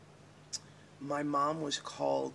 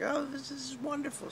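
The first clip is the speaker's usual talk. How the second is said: very high, gushing mother voice; 13 semitones higher than usual. Retold or imitated. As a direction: squeal it like a thrilled mom.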